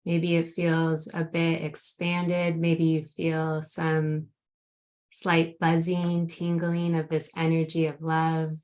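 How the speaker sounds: grounding meditation. Acted. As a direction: gently guide them into the present moment. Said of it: "would you lead us in a grounding meditation".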